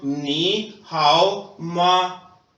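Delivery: नी हाओ माँ बहुत धीरे, शब्द-शब्द अलग; छात्रों के दोहराने के लिए धीमा अभ्यास; slow drill. Shown as a demonstration. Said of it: "अगर मैं आपसे कहता हूँ". (शिक्षक का बहुत धीमा दोहराव)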